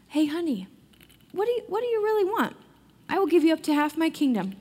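sweet-talking, eager to please; doting king. Retold or imitated. Storyteller voicing the king coaxing his queen, rising high and quick. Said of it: "Then the king asks Queen Esther".

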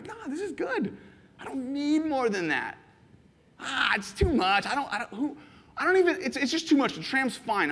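high, light, satisfied voice; politely turning the bigger gift down; content refusal. Retold or imitated. Visitor telling the host the tram is enough, high and content.

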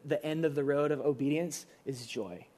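ordinary talk

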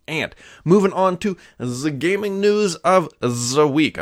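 playful fake German accent for the segment intro; mock German accent. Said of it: "I always do that with a German accent".

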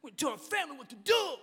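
mock domineering shout, fast; hammed-up tough-guy bravado; macho family boss. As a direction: bark it like a caricature of a bossy man. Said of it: "our culture taught us that standing up".

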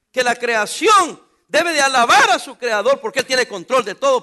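loud, slow shouted proclamation; preaching at full force. Real, not acted. Pastor proclaiming God's control to the congregation. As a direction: thunder it to the church.

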